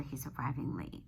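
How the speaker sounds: low, hushed whisper; hushed storyteller whisper. Acted. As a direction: drop to a secret whisper. Teacher narrating picture to kids.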